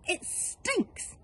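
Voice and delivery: high breathless it stinks; whispered high disgust. Acted. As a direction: gag at the smell. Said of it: "and Len said".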